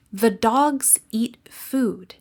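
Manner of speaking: dogs, eat, food stressed, The tucked in, slow; stress rhythm demo. Shown as a demonstration. Teacher demonstrates The dogs eat food, same beat. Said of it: "The words in yellow are stressed".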